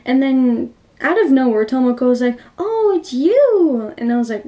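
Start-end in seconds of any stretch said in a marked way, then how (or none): 2.6-3.9 high, drawn-out surprised greeting; acted delighted recognition. Tomoko suddenly recognizing Mitsuko, acted delighted surprise. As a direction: swoop up high and stretch it out.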